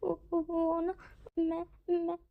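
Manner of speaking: high, soft stammering through tears; tearful. Acted. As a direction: choke on the words while wiping tears. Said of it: "अपने आंसू पोंछते हुए बोलता है". (आंसू पोंछते हुए धीमी हकलाहट)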